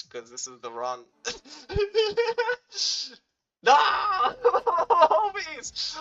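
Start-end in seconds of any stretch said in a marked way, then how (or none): 1.2-3.2 shrill burst of laughter; high laughing fit. Real, not acted. Loss on stream, laughing fit. 3.6-6.0 high, loud cry of disbelief; frustrated outburst. Real, not acted. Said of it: "I've actually getting mad".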